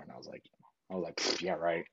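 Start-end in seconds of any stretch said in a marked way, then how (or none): 1.2-1.8 dismissive puff, then lower; retold scoff, brushing off praise; shrugging it off. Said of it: "I shrugged off like".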